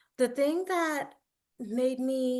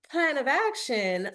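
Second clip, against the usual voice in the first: ordinary delivery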